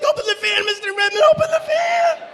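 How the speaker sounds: high, loud, rising panicked yells; frantic shouting. Retold or imitated. Players begging coach to unlock the van, shrill loud yelling. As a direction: scream at him to hurry. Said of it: "the boys are like".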